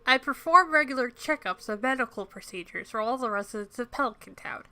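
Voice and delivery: pinched-nose nasal voice; Harvey nasal voice. Acted. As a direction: say it while holding your nose. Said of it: "I'm holding my nose to do his voice".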